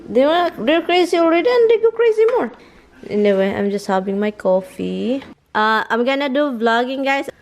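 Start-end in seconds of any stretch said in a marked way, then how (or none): 0.1-2.5 much higher, squealing about the dogs; excited squeal. Real, not acted. Telling viewers about the crazy dogs, squealing high. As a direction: rise into a squeal.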